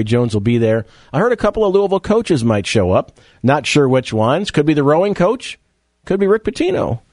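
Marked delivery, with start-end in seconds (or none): 4.5-7.0 questioning uptalk. Real, not acted. Radio host guessing which coaches might show up, questioning uptalk. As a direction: let each phrase swing up like a question. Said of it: "Why am I talking like this".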